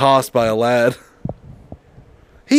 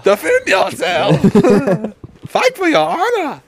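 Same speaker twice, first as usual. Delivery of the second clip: mock challenge; 11 semitones higher, slower than usual. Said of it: "I am fucking unleashing on you".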